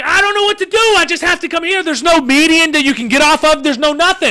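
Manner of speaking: high-pitched, frantic helplessness; retold freak-out. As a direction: act out panic with no way out. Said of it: "I'm freaking out".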